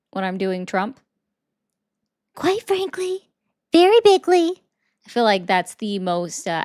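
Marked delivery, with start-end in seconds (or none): 2.3-3.3 mock petulant child, very high-pitched; deliberately silly high little-kid impression; Trump. Shown as a demonstration. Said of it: "He sounds like a petulant child". 3.7-4.6 put-on loud, high petulant-child voice; silly, squeaky mock impression at full volume; Trump. Shown as a demonstration. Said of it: "He sounds like a petulant child".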